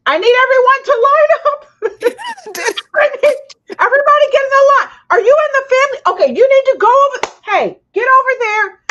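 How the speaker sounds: high, loud shout ordering people into place; taking charge. Retold or imitated. Friend rounding up wedding guests for photos, shouting orders. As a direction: holler commands like a bossy organiser. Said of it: "Charlena was the only person who said".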